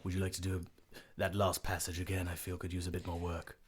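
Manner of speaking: low, soft, lordly; deep Joe voice. Acted. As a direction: croon the invitation low.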